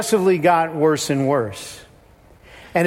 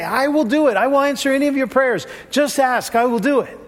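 higher-pitched mock genie promise; genie-like Jesus; 7 semitones higher than usual. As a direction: promise it like an eager wish-granting genie. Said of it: "Like some kind of genie".

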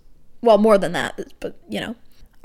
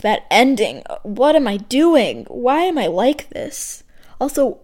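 ordinary talk

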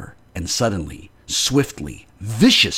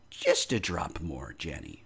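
soothing; 3 semitones lower, 9 dB quieter than usual. Acted. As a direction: gently coax her to drink. Husband soothes fainting wife.

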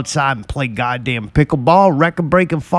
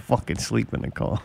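laughing repeat; 3 semitones lower, 8 dB quieter than usual. Real, not acted. Repeating line while laughing.

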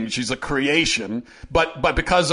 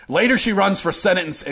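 usual speaking voice

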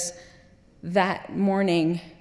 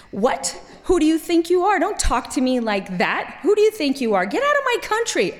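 put-on teen outburst; 7 semitones higher than usual. Teenage girl yelling at the leering soldier, indignant. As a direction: snap back at him like an outraged teenager.

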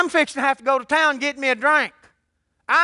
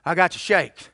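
shaking voice; 8 semitones lower than usual. Retold or imitated. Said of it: "and I said".

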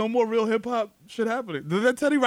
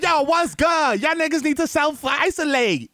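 raised, drawn-out mock street greeting; slangy official; 5 semitones higher, slower than usual. Imagined minister addresses the public, hammed-up street slang. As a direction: order the crowd around as a parody official. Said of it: "She's like".

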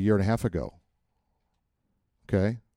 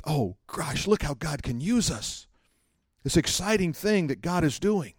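higher-pitched, awestruck gushing; acted wonder; 5 semitones higher, 3 dB quieter than usual. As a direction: marvel at it like an excited believer. Acting out wonder at God.

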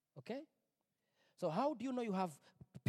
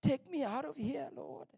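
raised, higher pleading cry to God; desperate plea; 7 semitones higher than usual. Retold or imitated. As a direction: beg God to get you out, voice lifted. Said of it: "I'm like".

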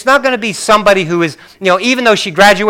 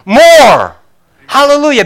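1 preaching shout; 9 semitones higher, 7 dB louder than usual. Real, not acted. Preacher's climax.

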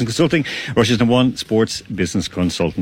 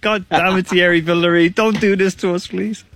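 higher-pitched, pleading mock dismay; mock exasperated plea; 8 semitones higher than usual. Host jokingly retells his reaction to lockdown, mock exasperated plea. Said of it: "I'm like".

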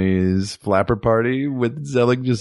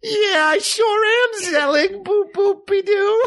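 very high, straining squeaky flapper voice; strained high flapper voice; slower than usual. Acted. Zelig at a flapper party, strained very high voice. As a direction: squeeze it up high like a twenties flapper. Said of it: "I can't keep up this accent anymore".

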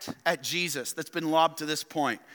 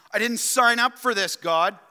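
louder griping voice of a believer; louder, put-upon grumble at God; complaining believer; 4 semitones higher, 5 dB louder than usual. Acted. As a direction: whine it up at God.

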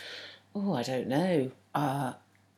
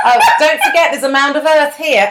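higher, louder pretend amazement at the trivial; mock excited discovery; 6 semitones higher, 6 dB louder than usual. Podcaster mocking a traveller's dull landmarks, mock excited. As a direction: exclaim as if spotting treasures, half laughing.

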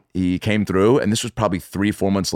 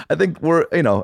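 usual speaking voice

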